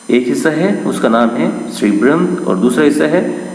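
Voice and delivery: ordinary talk, nothing marked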